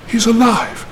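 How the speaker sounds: high joyful call; excited cry. Acted. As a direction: cry out the good news. Discovery of survivor.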